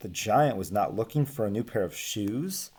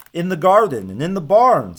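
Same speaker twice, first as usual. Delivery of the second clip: higher, louder eager answer; eager Hans; 6 semitones higher, 8 dB louder than usual. Acted. Hans answering the giant eagerly. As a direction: blurt out the good news. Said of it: "said Hans".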